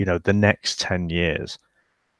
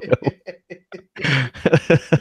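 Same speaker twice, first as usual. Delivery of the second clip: laughing; 8 semitones higher than usual. Real, not acted. Teasing reply with laughter.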